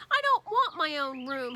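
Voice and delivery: put-on childish shout. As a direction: shout it like a stubborn small boy. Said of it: "he shouted".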